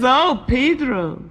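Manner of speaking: high, slow, drawn-out reply; indulgent, stretched-out name; Rosita's voice. Acted. Rosita explains to Pedro, slow drawn-out voice.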